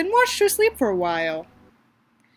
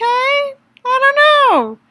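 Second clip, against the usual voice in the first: unsure; 6 dB louder, much slower than usual. Real, not acted. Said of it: "drawn-out unsure".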